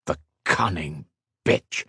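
low, slow contempt; seething anger. A narrator's reading. King curses the queen who trapped Ethan, seething. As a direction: force each word out slowly in anger. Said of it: "Veins bulged from his neck".

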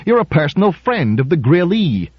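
high-pitched challenge; demanding. A narrator's reading. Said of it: "demanded Rubin".

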